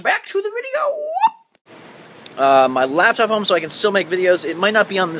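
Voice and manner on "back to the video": very high, squealed sign-back; high-pitched segue. Pretend. Vlogger throwing back to the video, high squeal. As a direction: send it way up high, held at the end.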